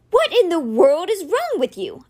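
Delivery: high, slow, emphatic shout; outraged, drawn-out demand; angry shout. A narrator's reading. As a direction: yell it in exasperated disbelief. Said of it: "Alex shouted, breathing hard".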